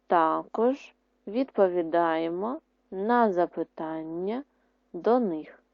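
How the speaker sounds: words drawn out for writing; slow dictation. Shown as a demonstration. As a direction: dictate slowly. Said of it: "Друге речення". (повільний диктант)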